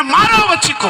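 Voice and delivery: high, angry kill command; angry order. Acted. Commander ordering the girl killed, high angry command. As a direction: order the killing in fury. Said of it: "सेनापति को गुस्सा आया".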